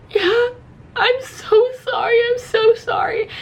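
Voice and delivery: high-pitched tearful apology; mock crying. Apologizing to the fireman, high mock crying. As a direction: squeal it as if in tears. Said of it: "I'm still crying a little bit".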